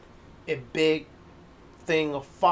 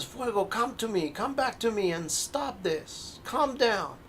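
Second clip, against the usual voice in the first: raised, anxious coaxing; pleading magician; 7 semitones higher than usual. Acted. Magician coaxing his fire pet back, high and anxious. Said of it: "he says".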